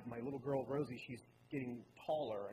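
ordinary talk, nothing marked

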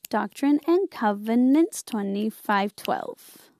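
normal talking voice

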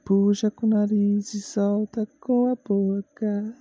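slow yoga breathing instructions; breathing guide, ending in a whisper; guided breathing. Shown as a demonstration. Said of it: "vamos respirar fundo agora".

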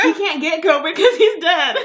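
slow, loud laughing punchline. Real, not acted. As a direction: laugh through it.

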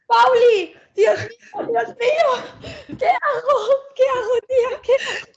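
real panic when the lights cut out; panicked screaming. Real, not acted. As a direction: shriek for help, really scared. Power cut alone at home.

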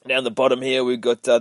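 normal talking voice

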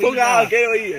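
loud, indignant mock yell; mock Irish outburst. Joking with friends about being Irish, loud mock yell. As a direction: shove them off with your voice. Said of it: "you gotta wake up and go".

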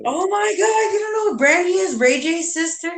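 high, fast outcry of shock; can't believe he doesn't know; shocked disbelief. Real, not acted.

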